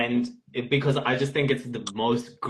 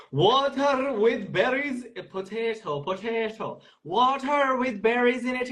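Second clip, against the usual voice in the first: mock Irish accent; 9 semitones higher than usual. Auditioning for a pretend casting director, a high mock Irish Caliban. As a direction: drag out "Water" in an exaggerated Irish lilt. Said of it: "And then Irish".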